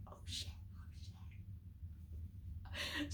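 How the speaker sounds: barely voiced, thrilled under the breath; whispered swearing in wonder; awed whisper. Thrilled at plane takeoff.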